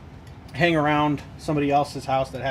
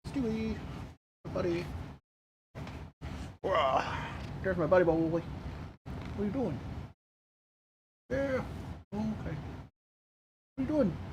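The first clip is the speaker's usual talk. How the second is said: soft pet cooing; 4 semitones higher, 9 dB quieter than usual. Real, not acted. Owner cooing softly to her cat Stewie. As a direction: murmur tenderly to the cat.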